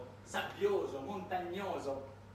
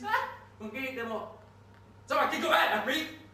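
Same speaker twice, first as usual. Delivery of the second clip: loud, higher, impatient questions; pushy oilman; 5 semitones higher, 8 dB louder than usual. Acted. Unocal envoy asking the Afghans who's in charge, loud and pushy. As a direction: demand an answer, getting exasperated. Oil man asks who governs.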